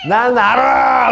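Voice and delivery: high, slow, loud knowing tease; imitating a giggling schoolgirl. Teacher imitates a laughing student to the class, high and loud. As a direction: mimic her sly laughing. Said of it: "이러고 웃는 거".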